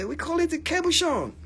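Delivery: hammed-up French accent for a punchline; French guy in joke. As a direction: swoop up high in a bad French accent. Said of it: "the French guy was".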